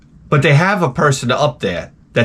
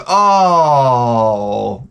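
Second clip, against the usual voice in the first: crowd's disappointed oh. Retold or imitated. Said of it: "the whole crowd goes".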